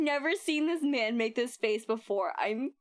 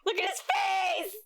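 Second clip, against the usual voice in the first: high excited squeal; delighted shout; 10 semitones higher than usual. Real, not acted. Streamer squealing at Edgeworth's expression.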